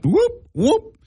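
high, excited whooping call; Chris Berman whoop. Retold or imitated. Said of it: "trying to do Chris Berman".

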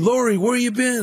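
high-pitched buddy imitation; retold friendly hailing. Friends question returning Greg, imitated hailing. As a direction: greet a long-missed pal.

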